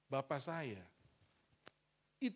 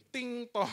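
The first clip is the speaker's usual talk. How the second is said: doorbell chime; 7 semitones higher, 7 dB louder than usual. Retold or imitated. Imitating the doorbell.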